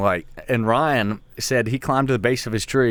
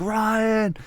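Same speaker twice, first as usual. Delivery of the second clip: yelling a name; 13 semitones higher than usual. Retold or imitated. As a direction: shout his name over the rain. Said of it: "you gotta yell to hear each other".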